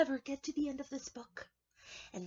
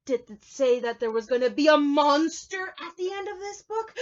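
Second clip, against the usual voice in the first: high, loud panicky monster voice; scared Grover; 7 semitones higher, 10 dB louder than usual. Acted. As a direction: gasp and panic like a frightened puppet. Grover frightened by monster warning.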